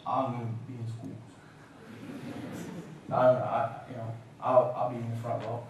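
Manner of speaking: low, very soft, hesitant answer; meek reply. Retold or imitated. Chastened son answering his mother about school, meek. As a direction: play a cowed kid giving in quietly.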